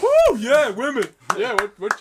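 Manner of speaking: high, loud hammed-up whoop; sarcastic over-keen cheer; mock cheering. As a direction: whoop like a rowdy crowd, in jest. Mock cheering the 'women' joke.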